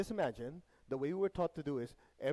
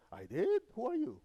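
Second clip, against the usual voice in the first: jokingly baffled, pitch leaping high; oblivious surprise; 9 semitones higher, 4 dB louder than usual. Acted. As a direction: play clueless disbelief, voice jumping up. Acting oblivious person being accused.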